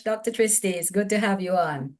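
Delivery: usual speaking voice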